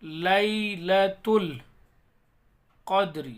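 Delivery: لیلۃ القدر with a bouncing echo on the final د; slow recitation, bounce the last د; القدر ending in a clear qalqalah bounce; qalqalah on dal. Shown as a demonstration. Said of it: "دال کے اندر قلقلہ کریں گے".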